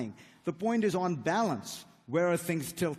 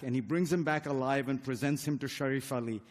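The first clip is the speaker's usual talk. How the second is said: ordinary delivery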